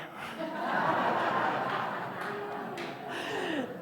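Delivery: long breathy unvoiced laugh; real laugh. Real, not acted. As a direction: laugh freely at the joke. Laughs after the punchline.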